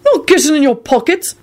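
high, indignant denial; offended at the accusation; outraged. A narrator's reading. Neville denying pickpocketing to Mundungus, outraged. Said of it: "Neville said in an outraged voice".

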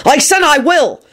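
defiant yell. A narrator's reading. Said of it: "Harry yelled back defiantly".

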